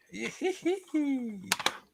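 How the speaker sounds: high, squealing giggle; drawn-out high-pitched hee-hee laugh. Real, not acted. Laughs at the word Chipotle.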